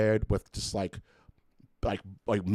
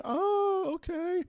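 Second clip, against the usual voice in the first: put-on squeaky kid voice; much slower than usual. Kid reacting meekly in story.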